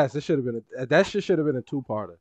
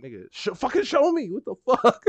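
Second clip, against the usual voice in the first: retold angry outburst; 12 semitones higher, 5 dB louder than usual. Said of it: "I was like".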